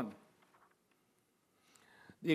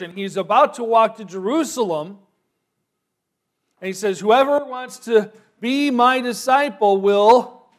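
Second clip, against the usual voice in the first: loud, higher, ringing preacher voice; preaching at full force; 4 semitones higher, 11 dB louder than usual. Acted. Preacher to congregation, rousing full-force preaching. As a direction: build the words to a rousing pulpit peak.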